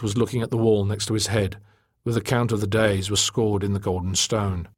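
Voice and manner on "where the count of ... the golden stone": plain reading voice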